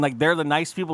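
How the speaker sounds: normal talking voice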